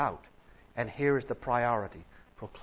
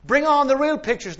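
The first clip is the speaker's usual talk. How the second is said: high, loud, eager yell; the crowd hollering for the main event; crowd shout; 16 dB louder than usual. Retold or imitated. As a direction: demand it, impatient. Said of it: "they shouted".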